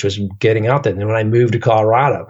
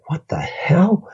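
high, slow, stretched disbelief; incredulous; 8 semitones higher, much slower than usual. Retold or imitated. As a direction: drag it out in mock shock. Disbelief at eighty miles in rain.

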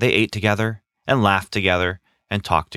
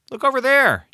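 shout. Acted. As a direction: shout to point something out. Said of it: "James shouted".

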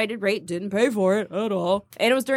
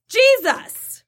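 high, drawn-out exclamation; exclaimed punchline; 12 semitones higher, 3 dB louder than usual. Acted. Telling friend who paid for the trip, exclaimed. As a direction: burst out with it, pitched way up.

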